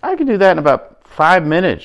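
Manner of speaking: put-on high, loud, cocky little girl. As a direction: boast it like a bold kid. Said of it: "she says to her mother".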